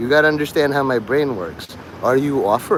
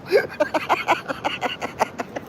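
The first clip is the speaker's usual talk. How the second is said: high airy laugh; 13 semitones higher, 6 dB quieter than usual. Real, not acted. Laughs at steakhouse joke.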